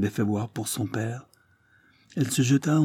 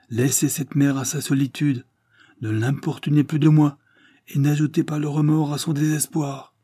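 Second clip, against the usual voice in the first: higher, louder urgent plea; agitated pleading; 6 semitones higher, 3 dB louder than usual. Acted. Feverish Henri begging his father, higher urgent plea. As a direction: press the plea harder and harder.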